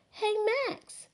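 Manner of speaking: twins' voice. Acted. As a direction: sing out like little sisters. Twin sisters call to Max.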